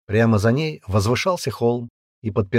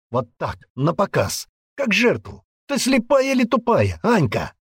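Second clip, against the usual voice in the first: high, urgent, pressing rant; agitated man; 9 semitones higher, 4 dB louder than usual. Acted. Man demanding the girl explain the killings, agitated rant. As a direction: argue heatedly, building to exasperation.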